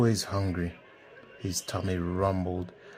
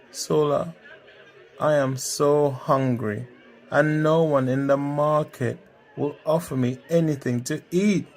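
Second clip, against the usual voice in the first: higher, lightly voiced hungry complaint; complaining Femi; 5 semitones higher, 4 dB louder than usual. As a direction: moan a little to a friend. Hungry Femi complains to his friend.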